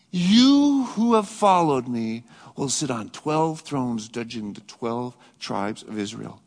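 slow, solemn promise with "you" emphasized; stress on you. Retold or imitated. Jesus promising the disciples thrones, "you" stressed. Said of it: "used that word you in the emphatic".

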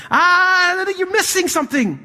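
a sudden high-pitched "ah!" to the congregation; high excited exclamation. Real, not acted. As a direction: jump up high to catch them out. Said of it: "preacher corrects the crowd".

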